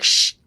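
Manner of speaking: voiceless shush; hushing. Acted. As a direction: hush it with a puff of air. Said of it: "be quiet for a second".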